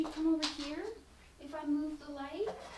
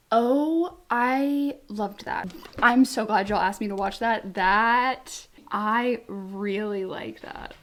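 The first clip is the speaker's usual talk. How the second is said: loud, slow, gushing praise; gushing excitement; 3 semitones lower, 8 dB louder, slower than usual. Real, not acted. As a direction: pour out how much she loved it. Gushing over the track.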